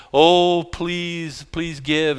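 high, drawn-out pleading, mock tearful; hammed-up tearful begging; mock tear-filled plea. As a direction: beg for money with put-on tears. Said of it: "you know, tear-filled".

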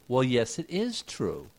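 usual speaking voice

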